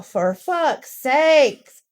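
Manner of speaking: slow, drawn-out exasperation; exasperated groan. Retold or imitated. Cashier venting after a frustrating customer, slow exasperated sigh. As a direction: stretch it out, fed up. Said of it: "I'm like".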